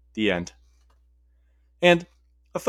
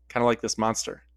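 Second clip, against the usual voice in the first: plain reading voice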